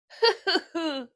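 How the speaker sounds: a short high giggling laugh; giggle. Acted. Lazy bulldog Otis giggles at Charlie's plan. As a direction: giggle at the silly idea. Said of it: "Otis giggled".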